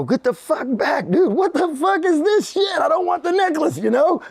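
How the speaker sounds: high, slow, drawn-out retold yell; hammed-up telling-off of the guy; mock angry yell. Yelling at the bum through window.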